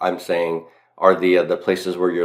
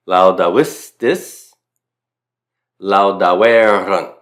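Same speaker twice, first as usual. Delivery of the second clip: each form spoken slowly and clearly, a pause after each; slow recitation; 5 dB louder, slower than usual. Shown as a demonstration. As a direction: recite the paradigm slowly for students to copy. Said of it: "now I just add the endings here".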